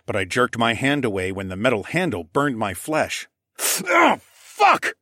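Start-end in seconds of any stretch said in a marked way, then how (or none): 3.9-4.9 sudden high, loud yelp of pain; pained curse. Acted. Narrator burnt by the metal handle, yelping.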